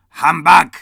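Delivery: very loud, high shout; shouted humbug. Acted. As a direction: roar it in disgust. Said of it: "followed up with".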